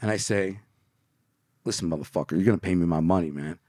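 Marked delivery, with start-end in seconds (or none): 1.6-3.6 low, controlled threat in a retelling; retold snapping threat. Young contractor demanding payment from a mobster, low menacing threat. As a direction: replay the threat low, starting softly. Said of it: "And then I snap".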